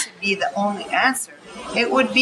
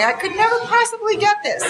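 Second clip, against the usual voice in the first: much higher, a helpless little voice; despairing inner voice; 11 semitones higher than usual. Retold or imitated. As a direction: squeak it out like you're lost. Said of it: "sitting in the back seat going".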